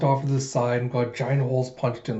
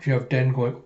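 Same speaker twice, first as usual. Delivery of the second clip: ordinary delivery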